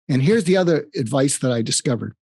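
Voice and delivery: ordinary delivery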